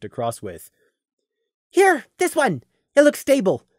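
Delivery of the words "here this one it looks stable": high, eager call across the ravine. Acted. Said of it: "Prey called out, panting".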